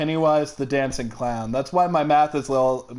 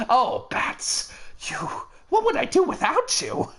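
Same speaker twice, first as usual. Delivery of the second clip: Joker taunt; 12 semitones higher than usual. Shown as a demonstration. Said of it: "decent at Heath Ledger's".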